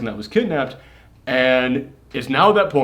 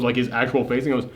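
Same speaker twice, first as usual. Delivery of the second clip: ordinary delivery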